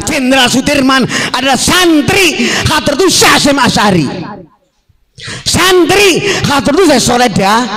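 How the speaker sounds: high, forceful rally oratory; impassioned sermon pitched high; fiery preaching. Real, not acted. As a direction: ring it out to the crowd at full force. Preaches at full force to crowd.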